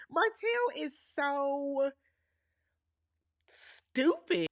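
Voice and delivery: slow, drawn-out build to a blunt verdict. Real, not acted. Vlogger calling Martell stupid, slow and blunt. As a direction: drag it out, then drop the word.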